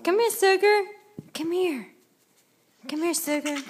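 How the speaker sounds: high sing-song call to the pet. Real, not acted. Owner calling her pet sugar, high sing-song coax.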